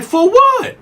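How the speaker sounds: squeaky high outraged question; indignant disbelief. Retold or imitated. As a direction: shoot up high like you can't believe it. Inmate protesting the write-up.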